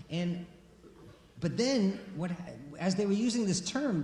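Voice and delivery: ordinary talk, nothing marked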